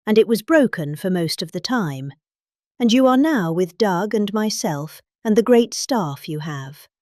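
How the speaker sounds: ordinary talk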